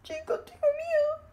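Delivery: high, crying squeak of fear; mock whimper. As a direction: play a scared little creature. Scared play-acted character. (lloriqueo fingido y agudo)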